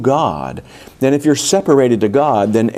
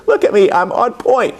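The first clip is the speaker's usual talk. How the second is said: much higher, bright eager dog voice; 9 semitones higher than usual. Retold or imitated. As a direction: pipe up like a dog begging for attention. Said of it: "will be like that dog, just going".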